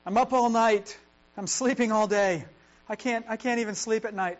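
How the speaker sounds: hammed-up sleepless moaning to God; desperate complaint. David's psalm of sleepless nights, loud lament. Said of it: "passages start with".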